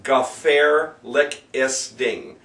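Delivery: very slow "Gefährliches Ding", syllable by syllable; broken into syllables. Shown as a demonstration. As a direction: sound out "Gefährliches Ding" piece by piece. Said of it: "this is a monster".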